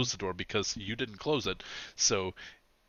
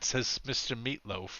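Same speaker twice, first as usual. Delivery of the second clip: ordinary talk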